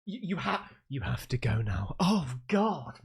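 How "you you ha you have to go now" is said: a hushed, breathy whisper, low and creepy; eerie whisper. Retold or imitated. Reacting to a creepy dream clip, echoing its line in an eerie whisper. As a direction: whisper it like a ghost in a nightmare.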